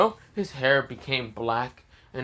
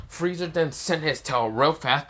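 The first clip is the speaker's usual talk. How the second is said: ordinary delivery, nothing marked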